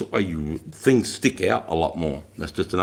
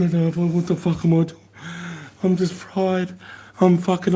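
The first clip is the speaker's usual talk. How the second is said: high, strained, fried recruit voice; a lightly frantic recruit gasping he's done; panicking recruit; 11 semitones higher than usual. Retold or imitated. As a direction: sound like a soldier cooked by the heat.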